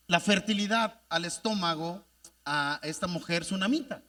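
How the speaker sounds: normal talking voice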